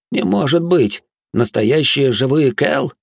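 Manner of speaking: high-pitched astonishment, slowing down; can hardly believe it, voice lifted high; amazed disbelief. A narrator's reading. Minos discovers what seem living Kel, amazed disbelief.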